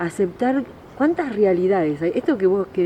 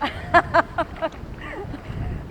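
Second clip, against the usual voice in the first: breathless high laugh; 11 semitones higher than usual. Real, not acted. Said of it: "está helada".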